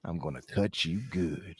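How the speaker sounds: low, soft mock-suave character voice; hammed-up samurai retainer. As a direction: play a smooth samurai, dropping low and quiet. Playfully plays the samurai retainer.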